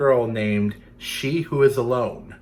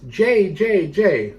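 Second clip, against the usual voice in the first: much higher, loud bird call; blue jay call; 8 semitones higher, 6 dB louder, slower than usual. Retold or imitated. Reader repeats the jay's cry for kids, high call. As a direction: chirp it like a jay. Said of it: "a blue bird that goes".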